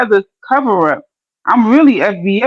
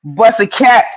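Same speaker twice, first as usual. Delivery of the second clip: ordinary delivery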